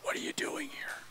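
a breathy, unvoiced whisper; the still small voice whispering; God's whisper. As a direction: whisper it gently into his spirit. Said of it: "that voice whispers to his spirit".

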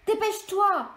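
yelled. A narrator's reading. The group urging trapped Sarah to hurry, shouting. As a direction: yell at her to hurry. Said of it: "hurle le reste du groupe".

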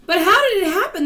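very high, loud group outcry; distressed cry. A narrator's reading. The travellers demand an explanation, distressed cry. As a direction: wail the question in panic. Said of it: "they cried".

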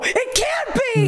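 thrilled disbelieving shout; excited. Real, not acted. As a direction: cry out in delight. Excited recognition of goblin.

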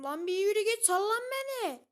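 angry gamer shout at opponent; angry yell. Real, not acted. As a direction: shout it high and loud at the enemy. Yelling at game opponent.

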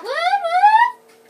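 high loud whooping cheer; excited whoop. Real, not acted. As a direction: whoop with delight. Cheering on the train.

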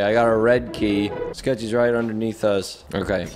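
ordinary talk, nothing marked

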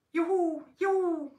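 high, loud whoop; cheering. A narrator's reading. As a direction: whoop with joy. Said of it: "jubelte das Wichtelmännchen".

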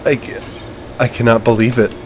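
quiet, tearing-up voice; softly choked up; emotional. Real, not acted. Gamer tearing up after the win, quiet emotional voice. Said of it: "I'm, like, emotional".

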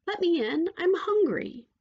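high, insistent princess plea; insistent pleading princess. A narrator's reading. Lost princess begs louder.

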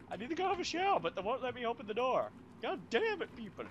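very high whiny complaint; mocking whiny voice. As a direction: whine it like a bratty kid.

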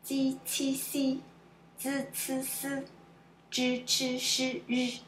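even groups from Ji to ri, each consonant distinct; sound drill. Shown as a demonstration. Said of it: "a few sounds which are different".

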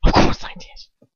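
curt breathy snap; snapping. Acted. Lucius snapping at Draco mid-protest. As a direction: cut him off sharply. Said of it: "Lucius snaps".

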